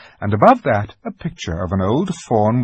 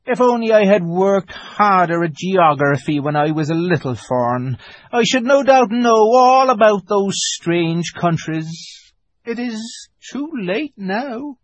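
melancholy; 9 semitones higher, 3 dB louder than usual. A narrator's reading. Fawn regrets neglecting geography, rather melancholy voice. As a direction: lament wistfully, dragging out the endings. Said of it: "in a rather melancholy voice".

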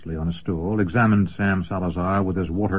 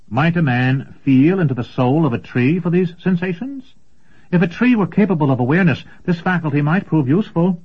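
much higher, eager dreamer; excited young apprentice; 7 semitones higher, 3 dB louder than usual. Acted. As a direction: share the idea with wonder. Apprentice shares his idea.

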